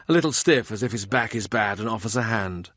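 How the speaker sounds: ordinary delivery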